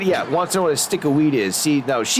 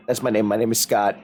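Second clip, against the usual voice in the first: ordinary talk, nothing marked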